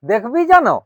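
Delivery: high, loud call. Acted. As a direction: raise the voice sharply to be heard. Calls out about crying child. (চড়া গলায় হাঁক)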